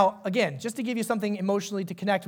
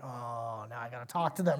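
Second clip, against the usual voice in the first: much lower and softer, a sagging aside; reluctant groan; 9 semitones lower, 8 dB quieter than usual. Retold or imitated. Churchgoer spotting a needy member at the hotel, much softer and lower. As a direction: sigh it out under your breath. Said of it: "You're like".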